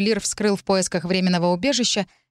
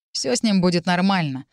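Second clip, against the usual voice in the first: plain reading voice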